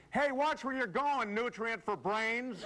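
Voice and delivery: high, indignant shout-out, drawn-out at the end; hammed-up annoyed call from the car window; mock road rage. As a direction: tell off the driver who cut you off. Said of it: "roll down your window and say".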